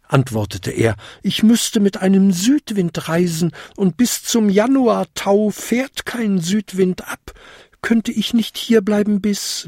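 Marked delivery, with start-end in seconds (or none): 1.2-9.6 high, small creature voice; pleading little goblin. A narrator's reading. Goblin asking old lady to stay, pleading. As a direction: plead your case in a high voice.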